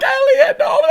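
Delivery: very high, loud hammed-up wail; imitated crying. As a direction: cry out like a heartbroken kid. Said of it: "then I cried".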